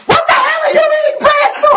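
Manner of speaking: angry yelling mom. Retold or imitated. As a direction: scream at the kid like an angry mother. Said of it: "The mom's yelling at him".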